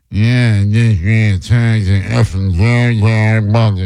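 low mumble, mimicking the caller; mocking mumbler impression. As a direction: imitate a mumble-mouthed caller, run words together. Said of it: "This mumble-mouth mushhead idiot".

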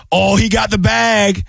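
high, drawn-out excited exclamation; imitated impressed reaction. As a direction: play the impressed buddy, stretching the words. Retells second man's excited reaction.

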